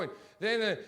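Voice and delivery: normal talking voice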